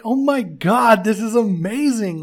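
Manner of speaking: loud, high retold amazement; excited gushing. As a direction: gush as if reliving the delight. Retelling own delighted reaction to film.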